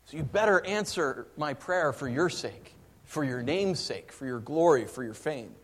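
preacher voicing David's bold plea; David pleading. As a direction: argue with God, pressing hard. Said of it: "He actually tells God why".

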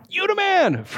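high, hammed-up cheer; mock cheer. Someone in the audience hailing Jesus, high mock cheer. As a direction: call it out high, like an overwhelmed fan.

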